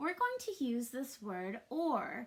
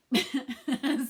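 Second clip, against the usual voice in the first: real laugh breaking out; 8 dB louder than usual. Real, not acted. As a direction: burst of real giggling. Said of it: "now I can't say but without laughing".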